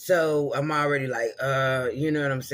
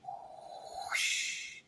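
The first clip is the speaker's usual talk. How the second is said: long soft rushing whoosh; whoosh sound; 10 dB quieter than usual. Shown as a demonstration. Replaying the orb's sound on the phone. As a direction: let the air sweep by. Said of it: "every time".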